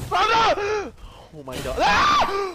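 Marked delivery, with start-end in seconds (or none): 0.0-0.9 yell. Real, not acted. Panicked yell. 1.8-2.5 scream. Real, not acted. Jump scare scream.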